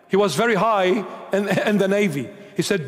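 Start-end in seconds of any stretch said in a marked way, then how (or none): none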